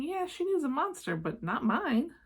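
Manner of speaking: put-on a touch of stubborn child; child's thought. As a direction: think it like a boy guarding his monster. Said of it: "He's thinking".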